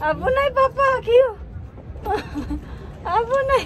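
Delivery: high urgent warning cry; alarmed warning. Real, not acted. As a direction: warn papa in alarm. Warning papa, danger.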